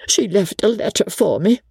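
high-pitched, fretful exclaiming; acted agitation, pitched high; agitated exclamation. A narrator's reading. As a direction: blurt it out as a flustered companion. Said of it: "Briggs exclaimed".

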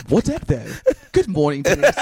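lightly put-on British accent. Host imitating Siri's greeting, light British accent. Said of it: "In his British accent".

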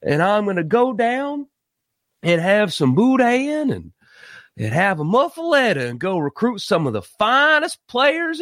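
slow, hammed-up fake Southern drawl; bad mock Southern accent, laid on thick and slow; lazy fake Southern accent from a new LSU coach; terrible fake Brian Kelly Southern accent. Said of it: "including terrible fake accents".

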